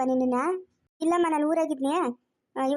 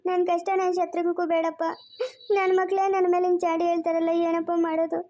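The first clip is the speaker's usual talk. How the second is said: sobbing plea to God; crying despair. Acted. Father begs God to take him away, in tearful despair. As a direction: cry out your despair between sobs. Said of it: "ಅಳ್ಬೇಡ, ಸುಮ್ನಿರು".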